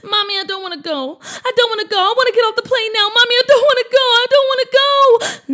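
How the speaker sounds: high, loud, fast tearful pleading; crying child. Acted. Boy begs his mom to get off the plane, crying loudly. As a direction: wail it like a panicked little boy. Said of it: "as he started to cry".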